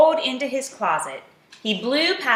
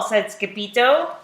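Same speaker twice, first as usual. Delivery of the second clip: ordinary delivery, nothing marked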